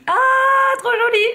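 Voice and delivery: high, thrilled squeal; squealing delight. Real, not acted. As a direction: gush over it, very high. Admiring the lipstick. (ravissement aigu)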